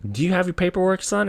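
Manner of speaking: high-pitched put-on cop voice; suspicious cop. Cop asks the driver for papers, mock suspicious voice. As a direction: imitate a cop questioning from on high.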